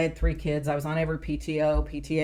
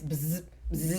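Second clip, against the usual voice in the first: short buzzing phone noise; imitated phone buzz; 7 dB quieter than usual. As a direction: mimic a phone vibrating. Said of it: "ringing on silent".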